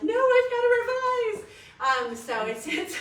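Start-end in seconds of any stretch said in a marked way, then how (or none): 0.0-1.5 self-quote. Retold or imitated. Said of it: "I'm like".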